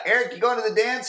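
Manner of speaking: put-on high girlish voice asking; imitating a girl. Imitating a schoolgirl asking Eric to the dance, high and sweet. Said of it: "she was like".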